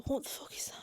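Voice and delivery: hushed, voiceless "what on earth" aside; under the breath, barely there; baffled whisper. Real, not acted. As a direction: whisper it to yourself in disbelief. Baffled aside while reading to herself.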